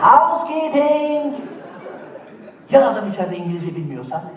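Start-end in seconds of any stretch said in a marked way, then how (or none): 0.1-1.4 high, slow drawn-out "Housekeeping!" through the door; hotel maid calling. Retold or imitated. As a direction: call it out like staff at a hotel door. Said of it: "Hani vardır ya odanın dışından". (kapının dışından yüksek ve uzatarak "Housekeeping!")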